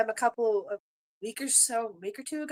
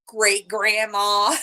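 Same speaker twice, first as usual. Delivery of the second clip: "Great grandma" drawn out very slowly and louder, as a playful jab; teasing comeback; 6 dB louder, much slower than usual. Retold or imitated. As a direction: hit back by stretching out "Great grandma". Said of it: "So I go back at her".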